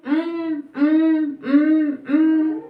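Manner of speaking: four hummed beats, high and slow; humming syllables. Shown as a demonstration. Teacher humming automatic's four syllables for the class. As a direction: hum au-to-ma-tic beat by beat. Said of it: "four बार इसको हम किया".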